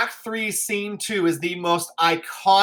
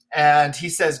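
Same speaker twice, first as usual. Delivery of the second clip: ordinary delivery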